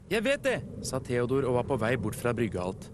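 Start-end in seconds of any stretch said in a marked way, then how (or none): 0.1-0.6 high-pitched little know-it-all; high, hasty character reply; impatient brush-off. A narrator's reading. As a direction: wave the instruction away eagerly. Theodor impatiently brushing off instructions.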